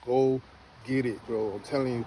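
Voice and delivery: usual speaking voice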